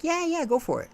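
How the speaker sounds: Zeus eagerly offering a dark deal, mock enthusiasm. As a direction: jump at the chance like a sly salesman. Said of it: "He's like".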